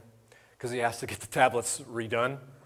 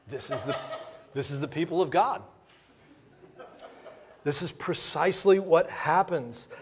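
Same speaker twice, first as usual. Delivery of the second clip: usual speaking voice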